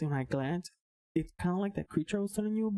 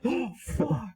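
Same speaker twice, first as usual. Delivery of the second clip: retold pained shock; 5 semitones higher, 4 dB louder than usual. Retold reaction to hitting head.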